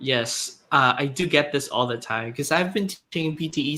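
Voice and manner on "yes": ordinary delivery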